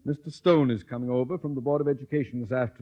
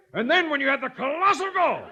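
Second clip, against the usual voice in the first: rising indignation; 12 semitones higher, 3 dB louder than usual. Acted. Principal recalls Miss Brooks' mishaps.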